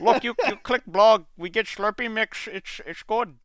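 put-on high, loud Indian accent; hammed-up Indian scammer accent. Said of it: "your Indian accent".

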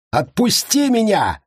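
high, desperate yell. A narrator's reading. Prisoner demands his captor release him, yelling. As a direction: cry out far up in pitch. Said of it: "заорал Кристиан".

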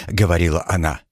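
ordinary delivery, nothing marked

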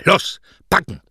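high, loud, impatient order; barked command. Acted. Mother throws suitcase on bed and orders packing, sharply. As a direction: bark it at your daughter.

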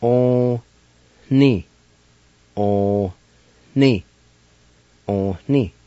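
"On ni" with a nasal n and an h breath; Cherokee pronunciation. Shown as a demonstration. Teacher modeling nasal "On ni" for learners, careful demo. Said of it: "like this".

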